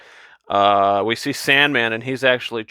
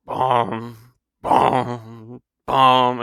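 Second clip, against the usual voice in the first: imitating the score; much slower than usual. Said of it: "[name]'s score is like".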